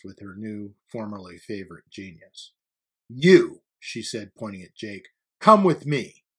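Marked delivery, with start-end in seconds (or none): 3.1-3.6 loud, curt summons; single the student out forcefully; stern command. A narrator's reading. Teacher pointing at Jake, sternly commanding. 5.4-6.2 high, loud order; stern command. A narrator's reading. Teacher displeased with her student, sternly commanding.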